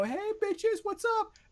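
hammed-up villainess hailing the crowd in a high voice; Hela's brash greeting. Co-host mimics Hela arriving in Asgard, brash high greeting. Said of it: "she walks up in Asgard and go".

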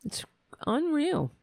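normal talking voice

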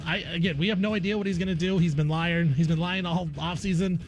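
ordinary talk

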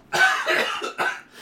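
choking cough on water; real sputtering cough. Real, not acted. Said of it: "water went down the wrong hole".

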